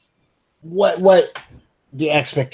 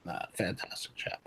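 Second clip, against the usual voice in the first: "fantastic chap" whispered again, breathy and much softer; whispered repeat; 4 semitones lower, 19 dB quieter than usual. Acted. The gentleman repeating his praise to himself in a whisper.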